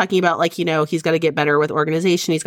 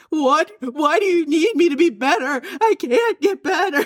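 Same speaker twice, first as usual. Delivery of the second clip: high, exasperated whine: what more do you want; mock whiny protest; 9 semitones higher than usual. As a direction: playfully play the put-upon sick wife, pitched way up. Mock whining reply to husband.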